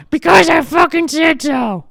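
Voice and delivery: high, loud granny shout; angry granny yelling at her grandson; grandma shouting. Retold or imitated. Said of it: "Why, Grandma?".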